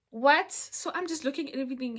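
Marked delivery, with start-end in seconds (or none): 0.1-0.5 loud, higher retold "what"; acted stunned question; shocked. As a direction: blurt it out in disbelief. Retold shocked reaction to the girl.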